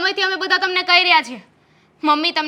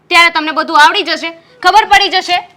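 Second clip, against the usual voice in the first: louder, sharp angry challenge; heated challenge; 3 semitones higher, 9 dB louder than usual. Acted. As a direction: throw it at him in anger. Wife challenges husband, heated quarrel. (ગુસ્સાથી ઊંચા અવાજે પડકાર)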